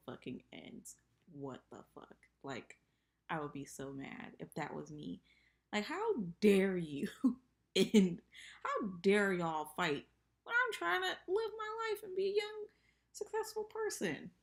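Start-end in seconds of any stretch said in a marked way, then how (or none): 5.7-14.3 high, loud mock rant; hammed-up outrage at the world; mock indignation. Pretending to be Timothy.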